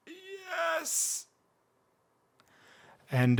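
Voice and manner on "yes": very high, soft, airy yes puffed out; breathless eager yes. Retold or imitated. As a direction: squeak out a yes with the last of your breath. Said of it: "to puff out".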